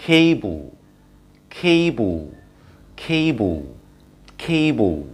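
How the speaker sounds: cable as "kay-bl", long ay; teacher modelling cable; right pronunciation. Shown as a demonstration. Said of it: "right প্রনাউন্সিয়েশনটা হচ্ছে".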